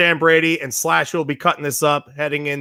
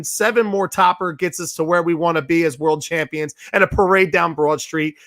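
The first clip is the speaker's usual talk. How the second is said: ordinary talk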